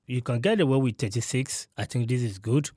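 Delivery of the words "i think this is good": ordinary talk, nothing marked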